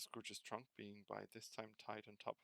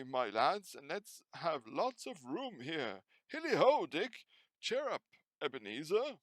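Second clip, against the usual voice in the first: big, booming, hearty call, much higher and louder; old Fezziwig calling his apprentices; rich fat jovial voice; 5 semitones higher, 14 dB louder than usual. A narrator's reading. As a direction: call out like a jolly old master.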